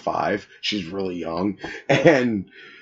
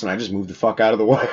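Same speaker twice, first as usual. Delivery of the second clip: laughing; faster than usual. Real, not acted. Laughs at own prank.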